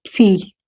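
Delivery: "फी" long, opened with a puff; aspirated American P. Shown as a demonstration. Teacher repeating American P for pupils, aspirated. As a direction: start "फी" on breath. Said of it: "पी को वो फी बोलते हैं".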